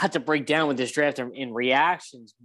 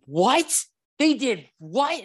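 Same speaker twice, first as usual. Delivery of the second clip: high-pitched, incredulous blurt; shocked disbelief; 8 semitones higher, much slower than usual. Retold or imitated. Fan recalling news of the trade, shocked and excited. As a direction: retell it as a stunned, rising double-take. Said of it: "I'm just, like, shocked, like, excited".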